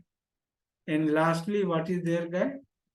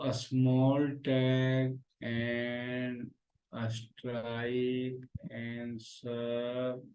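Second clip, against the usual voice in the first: slow reading out; 5 semitones lower, 5 dB quieter, much slower than usual. Real, not acted. Teacher slowly reading tag names.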